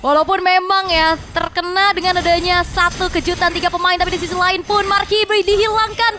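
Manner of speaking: excited play-by-play. Real, not acted. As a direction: ride the fight's momentum. Caster calls a team fight.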